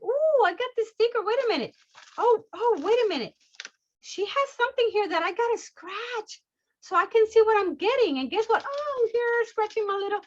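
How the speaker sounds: high-pitched, giddy discovery; excited customer. Acted. Customer finding a scratch sticker in her package, excited. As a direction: gasp with delight at a little surprise.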